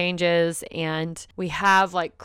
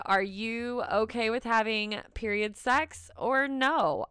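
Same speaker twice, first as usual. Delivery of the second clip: usual speaking voice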